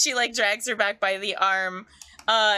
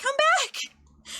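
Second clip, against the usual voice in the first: very high mother's call; calling after child. Acted. Mother calls after fleeing kid.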